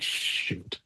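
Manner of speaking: a breathy whispered shoot; whispered dread. Retold or imitated. Golfers seeing Tiger walk to the range, whispering in dread. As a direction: whisper it like you just saw Tiger coming.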